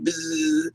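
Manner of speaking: high, soft imitated buzz; buzzing vibration imitation. Vibration going around you, soft high buzzing. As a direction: let a light buzz hum out. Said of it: "that kind of vibration just going around you".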